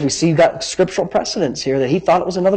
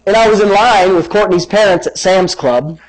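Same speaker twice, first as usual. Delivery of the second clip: normal talking voice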